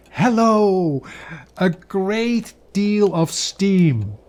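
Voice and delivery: excited hello. A narrator's reading. Announcing the pudding's arrival.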